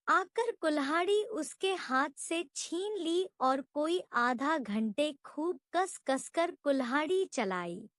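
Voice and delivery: ordinary delivery, nothing marked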